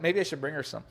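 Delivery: usual speaking voice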